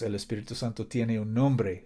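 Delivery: ordinary delivery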